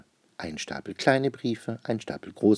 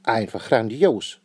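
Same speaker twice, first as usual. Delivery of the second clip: exclaimed; 3 semitones higher, 6 dB louder, slower than usual. Acted. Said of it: "ruft die Königin".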